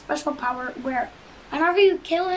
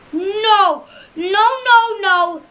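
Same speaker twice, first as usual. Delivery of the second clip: loud, higher yelled nos; desperate no; 4 semitones higher, 7 dB louder, slower than usual. Real, not acted. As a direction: shout in despair at the screen. Losing the fight, yelling.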